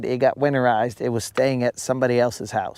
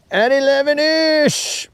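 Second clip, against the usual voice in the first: excited; 11 semitones higher than usual. Real, not acted.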